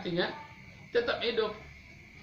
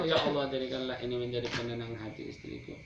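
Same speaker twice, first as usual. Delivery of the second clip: gentle prayer; 5 semitones lower, 4 dB quieter than usual. Pretend. Praying for wife.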